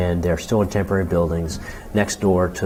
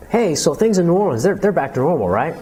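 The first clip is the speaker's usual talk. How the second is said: high, louder upbeat mock questioner; breezy outsider; 11 semitones higher, 5 dB louder than usual. Outsider asking a New Orleans local, breezy and high-pitched. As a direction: put on a chirpy, expectant outsider's voice.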